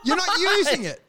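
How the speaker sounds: high, incredulous pleading with nan; exasperated grandson. Retold or imitated. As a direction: explain it like she's mad. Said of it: "I'm like".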